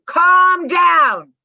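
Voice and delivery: loud, high bellowed command; mock yell. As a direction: yell it as if losing your own temper. Acts yelling at someone to calm.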